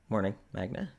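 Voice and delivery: hesitant and low, with long gaps; awkward Dimitri. Acted.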